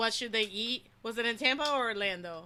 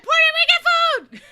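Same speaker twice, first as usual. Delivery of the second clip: very high, loud yell; screamed answer; 13 dB louder than usual. Retold or imitated. Fan retells screaming an answer at the idols, very high and loud. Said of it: "And I just screamed".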